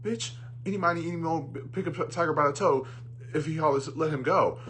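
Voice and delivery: high, loud mock counting rhyme; exasperated. Mock studio head scolding whoever brought the list, exasperated.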